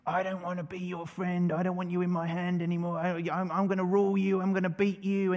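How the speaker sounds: lightly voiced tiny ant, puffed up and defiant; defiant little ant. Preacher voicing an ant defying the hand, mock bravado.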